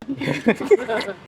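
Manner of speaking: short high-pitched laugh. Real, not acted. Joke about thirty-two trays.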